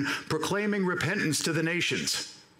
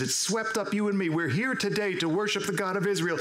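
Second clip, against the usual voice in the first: usual speaking voice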